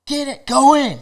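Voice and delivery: high-pitched mock yell; imitated angry yelling. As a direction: order someone off, imitating an irate local. Local ordering him out of water.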